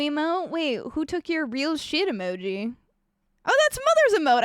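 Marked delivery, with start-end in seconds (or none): 3.5-4.4 much higher, louder delighted exclamation; light up with delight; delighted squeal. Real, not acted. Streamer spotting a friend's emote in chat, delighted.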